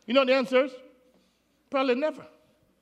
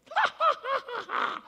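soft high laugh; 5 dB quieter than usual. Real, not acted. Preacher laughs mid-sermon.

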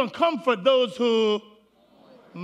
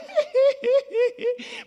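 high laugh; 14 semitones higher than usual. Real, not acted. Preacher laughs mid-sermon.

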